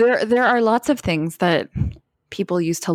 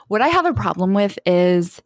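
ordinary talk, nothing marked

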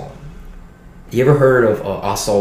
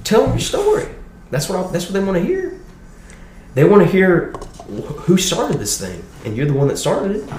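put-on Southern Baptist old boy; 11 semitones higher than usual. Dean of students reassuring the scared kid, hammed-up high Southern voice. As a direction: play the hearty Southern dean, pitched way up. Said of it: "He goes".

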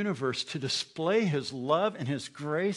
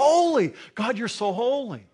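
much higher, louder "Holy"; ecstatic worship cry; 8 semitones higher, 7 dB louder than usual. Pretend. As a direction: burst out in awe. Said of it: "we're gonna go".

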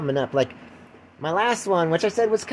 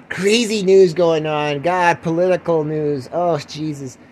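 ordinary talk, nothing marked

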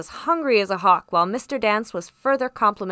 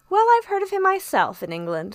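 composed doctor; 10 semitones higher than usual. A narrator's reading.

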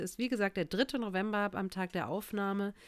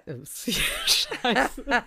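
laughing curse; 5 semitones higher, 6 dB louder than usual. Real, not acted. Cracking up after a slip.

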